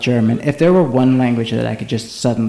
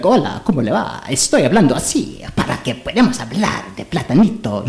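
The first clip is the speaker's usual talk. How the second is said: hammed-up, swooping telenovela Spanish; telenovela Spanish accent; 2 semitones higher than usual. Showing friends how they won't speak, hammed-up telenovela Spanish accent. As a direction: dazzle with an overdone Spanish accent. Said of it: "I stepped off the set of a telenovela".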